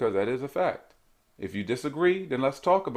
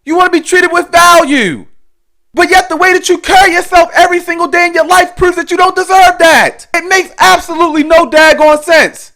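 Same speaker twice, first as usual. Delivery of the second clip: shouted, hammering motivational speech; loud lecturing rant; 15 dB louder than usual. Acted. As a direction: preach it hard at the viewer.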